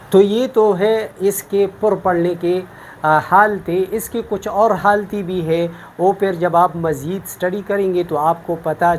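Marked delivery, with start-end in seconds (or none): none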